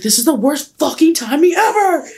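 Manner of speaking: high-pitched mock outburst; exasperated brother. Brother reacting to sister's call, high exasperated outburst. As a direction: imitate a fed-up brother complaining. Brother complains at bad timing.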